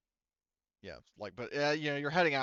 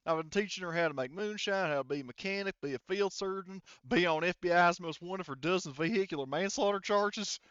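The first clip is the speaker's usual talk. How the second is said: louder, higher hillbilly brag; Texas hillbilly voice; 7 semitones higher, 5 dB louder than usual. Acted. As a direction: show off your cousin like a proud good old boy. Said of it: "He's like".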